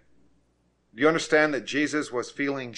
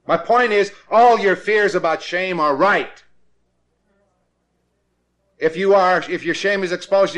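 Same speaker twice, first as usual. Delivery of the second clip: loud, higher preaching; preaching at full force; 6 semitones higher, 7 dB louder than usual. Acted. Preacher pressing his class with loud higher preaching. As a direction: drive every line home like a hammer.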